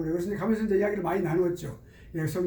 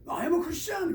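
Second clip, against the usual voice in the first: mock startled reply; 11 semitones higher, much slower than usual. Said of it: "그게 깜짝 놀라는 거야".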